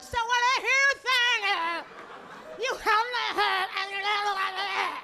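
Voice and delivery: hammed-up high, distorted therapist voice; weird-talking therapist. Therapist reflecting back to despairing client, exaggerated weird voice. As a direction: smear the counselling talk into high, odd noises. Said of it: "going to a therapist like that".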